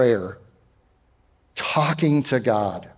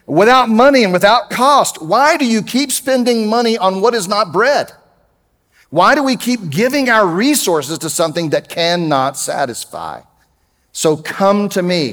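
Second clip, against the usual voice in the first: scripture reading; 6 semitones higher, 3 dB louder than usual. Acted. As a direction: proclaim the verses like an invitation from the pulpit. Reading continues.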